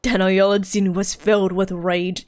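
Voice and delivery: low, measured tale-telling voice; dramatic storyteller. Acted. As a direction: intone it like an old legend. Reads storyteller character.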